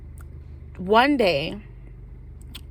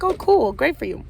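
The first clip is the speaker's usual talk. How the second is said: mock cheery; 6 semitones higher than usual. Fake-nice reply to friend.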